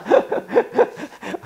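high, breaking giggle; laughing. Real, not acted. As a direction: laugh helplessly at your own joke. Amused at his own wordplay. (높은 소리로 킥킥 웃기)

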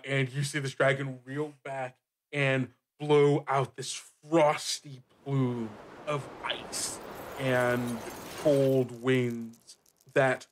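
usual speaking voice

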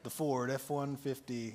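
lightly imitated low truck-commercial voice; deep-voiced truck ad guy. Preacher mimicking a truck commercial for the congregation, lightly deep voice. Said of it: "a guy in a deep voice".

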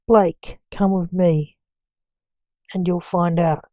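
usual speaking voice

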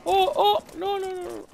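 high, loud panicked yelp. Real, not acted. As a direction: squeal in sudden alarm. Sudden danger in the game.

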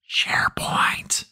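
slow, unvoiced whisper; whispered reveal. Real, not acted. Answering own question on most popular data source, whispered.

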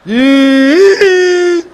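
high, loud stuck stammer on he; hammed-up desperate stutter. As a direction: strain and stick on he. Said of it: "he stutter real bad".

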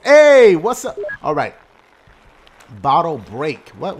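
ordinary talk, nothing marked

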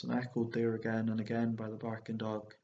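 ordinary delivery

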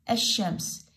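الشمس with a long sh straight after the A; silent lam. Shown as a demonstration. Teacher shows the sheen is heard, lam silent. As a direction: say الشمس as "ash-shams", dropping the L. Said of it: "لا، اللام ما تلفظ".

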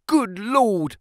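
high, sudden outcry of dismay; dismayed cry. A narrator's reading. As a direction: cry it out, pitch leaping up. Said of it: "he cried".

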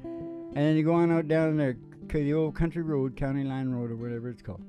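ordinary delivery

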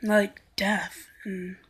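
slow, breathless whisper; emo whisper. Acted. Emo vlogger whispering about death to viewers. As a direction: breathe it out like a gloomy goth. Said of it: "I was acting like an emo person".

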